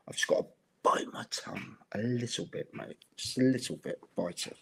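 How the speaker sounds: whispered so nobody overhears; hushed tones. Real, not acted. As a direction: keep it hushed and secretive. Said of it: "Are you speaking in hushed tones".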